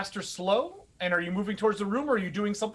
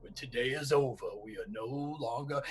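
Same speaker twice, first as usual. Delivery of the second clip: low, much softer menacing growl; Blythe's threat; 8 semitones lower, 6 dB quieter than usual. Acted. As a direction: threaten the little goblin quietly. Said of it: "Blythe looks up and goes".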